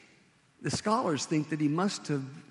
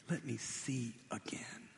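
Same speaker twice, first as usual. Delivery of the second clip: breathless whisper, barely any voice; whispered plea; 2 semitones lower, 11 dB quieter than usual. Retold or imitated. Blind beggar repeating his plea to Jesus in a whisper. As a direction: whisper it like a prayer.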